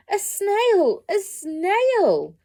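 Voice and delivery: high, loud startled cry; shocked teacher. A narrator's reading. Teacher spotting the snail, loud and high. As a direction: shriek at the sight of it.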